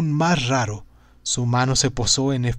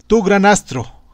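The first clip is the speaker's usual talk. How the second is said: grand proclamation; 6 semitones higher, 6 dB louder than usual. Acted. Zarathustra addresses the sun.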